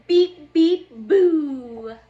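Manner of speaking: loud playful beep beep boo. Acted. Truck surprising the party guests, loud playful boo. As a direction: honk then spring a boo on them. Truck spooks friends at end.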